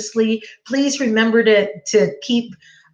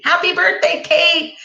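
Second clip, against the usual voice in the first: excited greeting; 7 semitones higher than usual. Real, not acted. Teacher greets birthday student.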